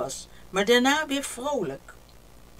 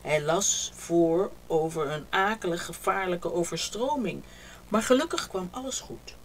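usual speaking voice

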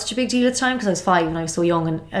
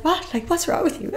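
much higher, incredulous quoted retort; mock disbelief; 11 semitones higher than usual. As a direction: play her baffled younger self, pitched way up. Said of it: "And I was like".

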